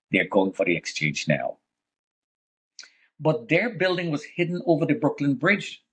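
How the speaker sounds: ordinary delivery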